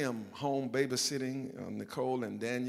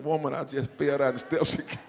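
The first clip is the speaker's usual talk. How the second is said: much louder exasperated outburst, breaking into a laugh at the end; 4 semitones higher, 9 dB louder than usual. Retold or imitated. Husband finally tells his wife he fell again, loud exasperated outburst with a laugh. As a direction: blurt it out at last, half laughing.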